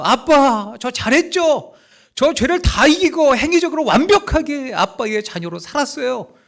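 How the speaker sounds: put-on high, bright-eyed child boasting to his father; eager child to daddy. Preacher voices a believer bragging to God, high eager child voice. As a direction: run up to Daddy and brag about being good.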